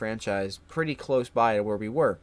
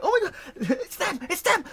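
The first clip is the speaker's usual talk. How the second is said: much higher, giddy mock panic; excited whisper-shout; 3 dB louder than usual. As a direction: act out coworkers spotting the thieves. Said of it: "they're like".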